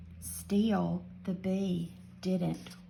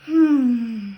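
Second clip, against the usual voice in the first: big yawn; 3 semitones higher, 11 dB louder than usual. Acted. Narrator acts the rabbit's yawn.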